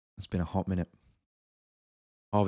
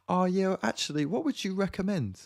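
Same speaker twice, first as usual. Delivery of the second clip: very high, mock confident customer; high pretend-knowing voice; put-on bluffing voice; 13 semitones higher, 3 dB louder than usual. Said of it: "I put on a little voice".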